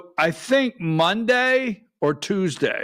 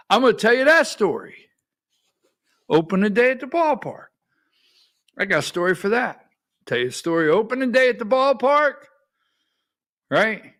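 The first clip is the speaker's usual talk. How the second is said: excited; 5 semitones higher, faster than usual. Real, not acted. Host hyping the coming story.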